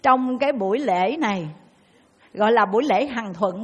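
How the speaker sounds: normal talking voice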